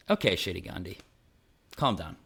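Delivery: low, dismissive put-down, lightly voiced; mocking inner voice. Inner critic mocking Martin Luther King mid-speech, low sneer. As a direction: talk him down with a lazy sneer. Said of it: "his inner voice was like".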